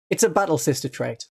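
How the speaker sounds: ordinary delivery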